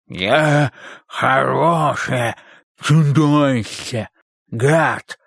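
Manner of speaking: slow, hoarse monster roar. Acted. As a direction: growl it like a friendly monster. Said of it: "хрипло проревел Кёртис, изображая чудовище". (хриплый рёв чудовища)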